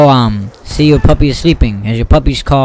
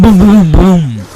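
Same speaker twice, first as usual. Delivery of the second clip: explosion sounds; 4 semitones higher, 4 dB louder, slower than usual. Retold or imitated. Imitating explosions.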